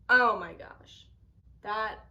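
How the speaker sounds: normal talking voice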